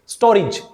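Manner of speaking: स्टोरेज said as STO-rij; correct pronunciation. Shown as a demonstration. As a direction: say स्टोरेज with "ri" instead of "re". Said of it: "ये रे बन जाएगा री". (स्टोरेज का सही उच्चारण, "स्टोरिज")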